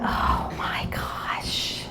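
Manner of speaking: slow, hushed realization; stunned whisper. Retold or imitated. Mother realizing her daughter heard everything, stunned whisper. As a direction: whisper it slowly, as a dawning thought. Acted stunned thought after the revelation.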